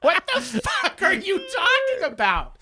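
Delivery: high, slow, laughing imitation of disbelief; mock bewildered. Housemate answering Angela's accusation, mock baffled. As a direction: stretch it out incredulously, half laughing. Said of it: "And he's like".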